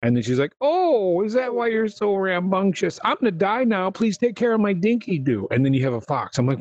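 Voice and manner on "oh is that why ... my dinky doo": high mock old lady, hammed-up dying; bad old lady impression. Dying lady asks hero to keep pet, high hammed granny. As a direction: squeak it out like a fake granny on her deathbed. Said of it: "impression of an old lady".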